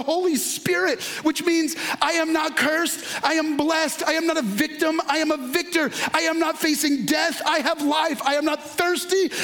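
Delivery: impassioned preaching. Acted. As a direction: build a fervent litany of affirmations. Leads a fervent declaration.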